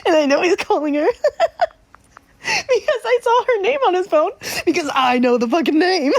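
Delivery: high, loud, talking through laughter; real laughter breaking into the words; gleeful laughing. Real, not acted. Trickster cracking up as the man phones his girlfriend, gleeful.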